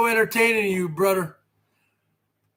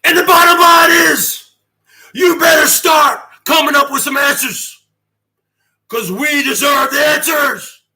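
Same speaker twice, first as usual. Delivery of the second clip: high, loud shouted demand; sudden shouting; 4 semitones higher, 6 dB louder than usual. Shown as a demonstration. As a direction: jump to a full-voiced shout, demanding. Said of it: "I can go from here to here".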